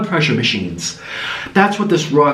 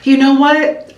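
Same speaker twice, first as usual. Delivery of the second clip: high mock protest; Kathy impression; 10 semitones higher, 4 dB louder, slower than usual. English guest quitting the retreat after four days, high-pitched mimicry. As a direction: imitate a fed-up guest speaking up. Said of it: "Kathy was like".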